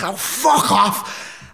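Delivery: high, drawn-out mock yell; retold screamed retort. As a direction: stretch it out in exasperated imitation. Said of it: "started screaming at each other".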